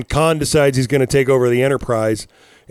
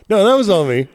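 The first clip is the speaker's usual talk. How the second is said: ordinary delivery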